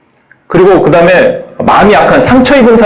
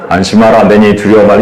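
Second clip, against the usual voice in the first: encouraging; 7 semitones lower than usual. Shown as a demonstration. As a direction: soothe frightened disciples gently. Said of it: "격려".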